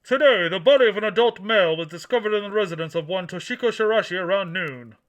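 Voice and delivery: booming newsreader delivery; news announcer voice. Pretend. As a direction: read it like a TV anchor. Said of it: "put my announcer voice on".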